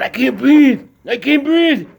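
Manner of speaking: high, loud, strained cry of not being able to breathe; retold desperate scream. Trump in his dream under the knee, pleading in panic. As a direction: gasp it out, pleading, in rising panic. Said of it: "He's screaming".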